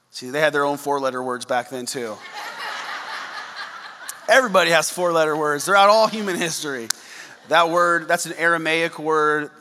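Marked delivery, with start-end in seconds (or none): 2.2-4.2 airy unvoiced chuckle; soft breathy laugh; breathless laugh. Real, not acted. Laughs at own joke.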